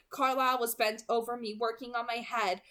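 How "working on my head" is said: ordinary delivery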